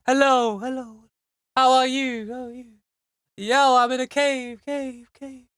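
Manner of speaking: high calls that echo back and fade; echoing cave voice. Shown as a demonstration. Someone calling out in a cave, high echoing calls. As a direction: call out as if your own echo rings round a cave. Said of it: "imagine you're in a cave".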